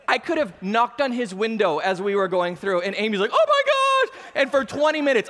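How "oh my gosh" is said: loud, very high-pitched mock shriek; alarmed wife. Pastor imitating his wife in the car, alarmed.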